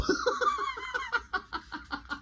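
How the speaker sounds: real squealing laugh; high laughter. Real, not acted. Host cracking up at the comic, real high laugh.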